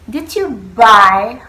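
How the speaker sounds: slow, loud, stressing you; careful demo. Shown as a demonstration. Student shows classmates the phrase slowly. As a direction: model the question slowly for the class. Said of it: "buy is buy".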